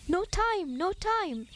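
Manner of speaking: hurried and a bit out of breath; rushed, busy little ant voice; puffing. A narrator's reading. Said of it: "Anthony would puff".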